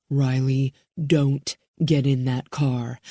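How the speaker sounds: a man trying to stop her; tense low man's voice. A narrator's reading. Bowman warns her.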